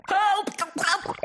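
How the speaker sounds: high-pitched, acted distress calls; cry for help. A narrator's reading. Someone in a shark tank calls out, high cry for help. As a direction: call out for rescue.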